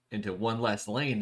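ordinary delivery

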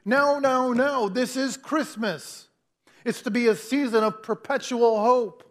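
a mother at the ticket counter losing patience, slowing on this is Christmas; frustrated outburst. Retold or imitated. Mom at the airline counter, angry and pleading. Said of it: "In, in sheer anger, frustration, irritation, she bangs on the counter".

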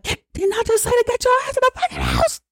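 very high mock scream; mock screaming mother. Lois screaming at Agnes to get inside, high mock scream. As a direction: shriek it like a furious mother. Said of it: "screaming at her".

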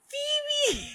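very high, thin fan call; mock fan squeal. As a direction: squeal the name like an imagined fan. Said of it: "for you to be like".

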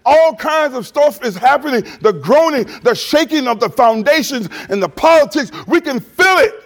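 full-force sermon build; fiery preaching. Acted. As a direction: preach it to the whole church.

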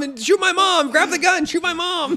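very high, loud manic cry; frantic dog voice. Retold or imitated. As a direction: yell it like an unhinged cartoon dog. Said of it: "dog characters yelling in game".

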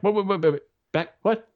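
high-pitched, rapid-fire bewildered repeats; mock startled stammer. Telling how the instructor's news caught him out, startled. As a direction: act out being caught off guard, pitch jumping up. Retold shock at being called up.

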